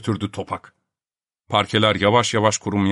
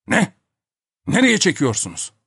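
alarmed, higher-pitched protest; cutting in; 7 semitones higher than usual. A narrator's reading. Topak seeing his plate being taken, alarmed cutting in. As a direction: jump in to stop them. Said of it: "diye atıldı Topak".

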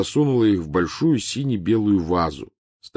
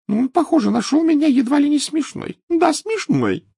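rising, shrill indignation; hysterical rise; 7 dB louder than usual. A narrator's reading. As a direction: voice climbing to hysteria. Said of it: "Голос её истерично возвысился".

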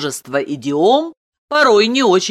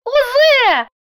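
put-on very high child voice; little girl declaring; 4 dB louder than usual. A narrator's reading. Daughter tells papa it is already done, in child voice. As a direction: announce it like a small child. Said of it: "заявила Маша".

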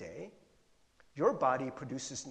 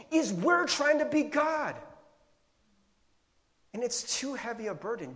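usual speaking voice

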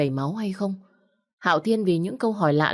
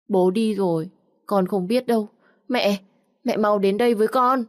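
put-on higher child voice, a touch of tears; small boy pleading, mildly tearful; tearful little boy; 5 semitones higher, 3 dB louder than usual. Hurt son begging his mother to come, higher mild tearful child voice. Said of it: "tiếng khóc của Nhược Đông".

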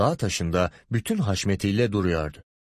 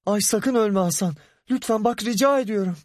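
panicked pleading; 11 semitones higher than usual. A narrator's reading. Ahu begs dying Hasan.

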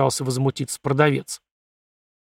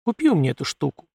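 plain reading voice

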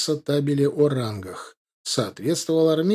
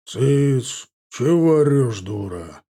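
short scolding shout, dropping at the end; gruff bass rebuke; 4 dB louder, much slower than usual. A narrator's reading. Master silencing the yelling servant woman, gruff rebuke. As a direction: hush her roughly, then let it fall. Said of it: "послышался басистый окрик".

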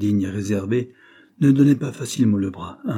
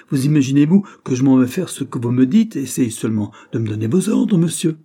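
higher, much louder indignant cry; angry outburst; 5 semitones higher, 8 dB louder than usual. A narrator's reading. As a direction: explode at the rival clerk. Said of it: "s'écria-t-il".

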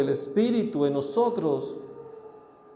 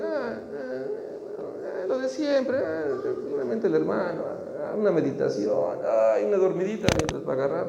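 lazy churchgoer. Retold or imitated. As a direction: drag out the excuses like you can't be bothered.

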